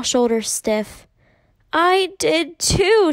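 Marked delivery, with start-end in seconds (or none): 1.7-3.1 put-on high, loud, slow indignant child; belligerent. A narrator's reading. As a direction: stiffen up and insist, drawing it out high. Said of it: "she said belligerently".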